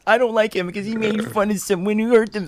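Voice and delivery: high, whiny mocking whine; mock a crybaby complaining. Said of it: "We're not crybabies".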